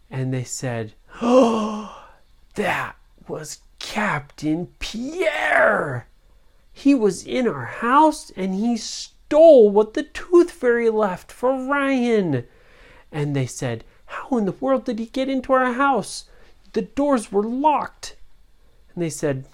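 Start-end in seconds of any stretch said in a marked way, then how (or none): 1.2-12.5 excited discovery. Acted. Parents discover pirate thief. 14.1-18.2 amazed parents. Acted. Parents puzzled by break-in.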